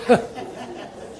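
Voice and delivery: a quiet breathy chuckle between words; soft laugh. Real, not acted. Preacher amused at naming the old sisters, quiet chuckle. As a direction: let a little laugh slip out.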